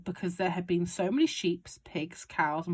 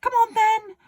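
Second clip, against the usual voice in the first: high, loud, gleeful father; excited Mister Cranky; 11 dB louder than usual. A narrator's reading. Father urging George on about the medicine, high and loud. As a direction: crow it like a man with a scheme. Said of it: "cried Mister Killycranky".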